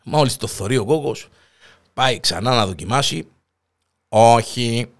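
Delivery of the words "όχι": robot voice. Retold or imitated. Robot refuses, louder.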